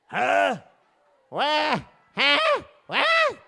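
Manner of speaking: short, high questioning yelps; uncomprehending deaf man. Acted. Husband failing to hear his wife, high baffled queries.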